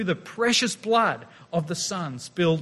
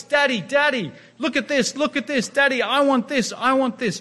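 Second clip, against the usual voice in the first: put-on a little higher, insistent child; demanding little child; 6 semitones higher than usual. Preacher imitating a noisy child, a little higher. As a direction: pester dad like a noisy kid. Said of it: "A little child. Snotty, noisy".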